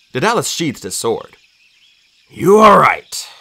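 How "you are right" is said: slow, loud, weighty concession; measured, emphatic admission; solemn agreement. A narrator's reading. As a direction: concede it deliberately. Daedalus concedes the grim point.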